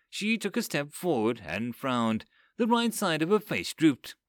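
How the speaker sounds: ordinary delivery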